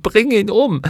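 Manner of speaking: the rage peaks on the repeat; screaming in rage. A narrator's reading. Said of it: "packte seine Arme und schrie".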